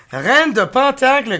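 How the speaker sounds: Reine de Pentacle in a loud, high French accent; French reading. Shown as a demonstration. Reading the card aloud, loud French accent. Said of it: "Queen of Pentacles".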